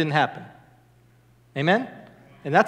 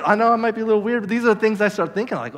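ordinary delivery

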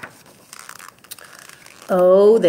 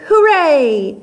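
cheering; 5 semitones higher than usual. Acted. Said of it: "all the animals yelled".

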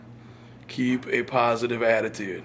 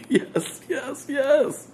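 laughing; 11 semitones higher, much slower than usual. Real, not acted. Laughing at own toy trick.

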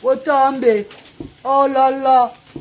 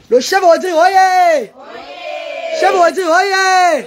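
calling out; 6 semitones higher, slower than usual. Real, not acted. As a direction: cry it out like a town crier. Calling out to the chef.

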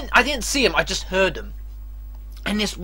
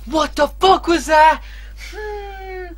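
ordinary delivery

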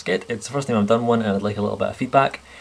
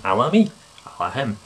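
ordinary talk

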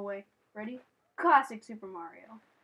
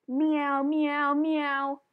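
meowing; 2 semitones higher, much slower than usual. Real, not acted. Meowing at the cat.

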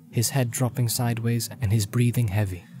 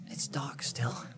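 soft drowsy Frodo; sleepy whisper; 3 semitones higher, 12 dB quieter, slower than usual. Acted. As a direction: murmur it half asleep. Frodo just woken.

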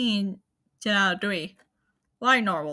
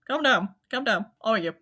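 ordinary delivery

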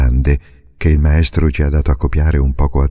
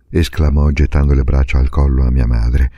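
ordinary delivery

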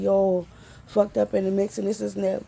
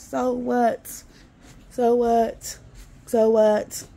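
each so what louder; building defiance; 5 dB louder, much slower than usual. Real, not acted. Daring the censors, building from normal to loud. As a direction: grow louder with every so what.

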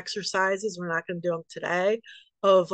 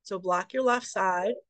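ordinary talk

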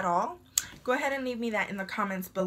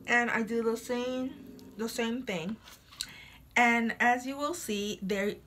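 talking with strips on; sticky film on teeth; whitening strips on teeth. Real, not acted. Seen 2: strips pressed onto teeth, then shows coated teeth while talking.

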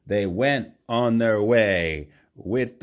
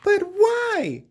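son starting to cry; 3 dB louder than usual. A narrator's reading. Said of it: "asked his son as he started to cry".

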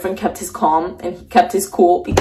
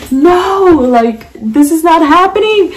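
high, louder protest of disbelief; retold frantic denial; 8 semitones higher, 4 dB louder than usual. Woman reliving the moment she lost her car, frantic denial. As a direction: re-enact refusing to believe it. Retold refusal during carjacking.

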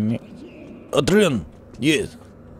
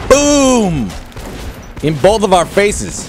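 loud, much higher shout; loud boom shout; 9 semitones higher, 8 dB louder, much slower than usual. Real, not acted. Player hit by own bomb, loud shout.

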